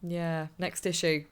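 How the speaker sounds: ordinary delivery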